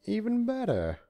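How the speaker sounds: high, bright exclamation; sudden high cheer; exclaiming. A narrator's reading. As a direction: burst out delighted. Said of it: "he exclaimed".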